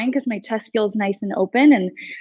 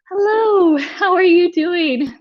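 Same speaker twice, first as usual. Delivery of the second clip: much higher, sing-song greeting, slowing on the question; high chirpy hello to the class; bright playful hello; 8 semitones higher than usual. Real, not acted. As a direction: greet them like a cheery friend dropping by.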